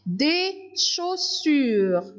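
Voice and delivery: slow and clear; slow dictation. Shown as a demonstration. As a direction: articulate for young pupils. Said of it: "dictation of word, slow".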